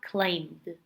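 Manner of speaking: "climbed" said as "climd"; one syllable, "climbed" ending in D; final D sound. Shown as a demonstration. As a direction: finish "climbed" on D, no E vowel. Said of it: "sounds like a D and without the vowel".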